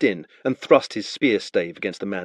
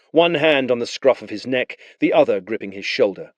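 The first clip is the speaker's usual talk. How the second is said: normal talking voice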